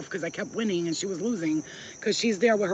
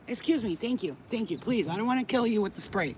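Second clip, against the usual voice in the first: normal talking voice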